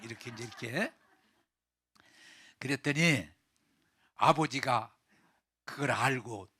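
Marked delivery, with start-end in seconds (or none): none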